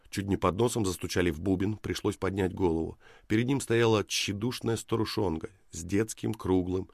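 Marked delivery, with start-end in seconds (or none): none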